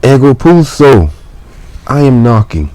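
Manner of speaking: high, loud answer through the door; mailman answering. Acted. Mailman answering the janitor. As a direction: call back through the door.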